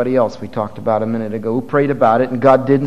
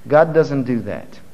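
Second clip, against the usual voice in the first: ordinary talk, nothing marked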